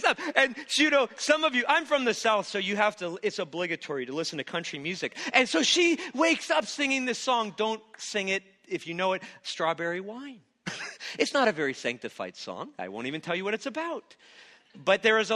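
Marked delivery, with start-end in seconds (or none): none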